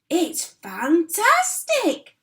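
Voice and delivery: high, loud and slow exclamation; excited shout. A narrator's reading. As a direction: shout it with wonder. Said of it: "Mister Cranky shouted".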